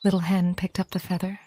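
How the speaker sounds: ordinary delivery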